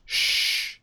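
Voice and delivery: unvoiced-style hushing "shh"; imitated shush. Killer hushes Deathstroke after the kill, imitated shush. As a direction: hush someone with a drawn breath of "shh". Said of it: "she kills him and just goes".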